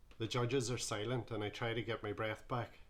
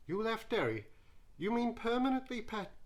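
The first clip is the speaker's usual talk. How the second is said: high, surprised Geordie judge voice; 11 semitones higher than usual. Acted. Judge quizzing the young contestant, high and surprised. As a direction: lift it up in disbelief, pet.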